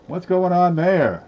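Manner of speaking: sudden high puzzled question; startled, drawn-out what's that; surprised. Real, not acted. Surprise at a marked coin.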